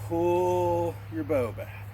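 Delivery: slow, stretched cue; drawn out. Shown as a demonstration. Teacher slowly talks students through drawing the bow. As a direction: let the words pull like a bowstring. Demonstrating archer pose.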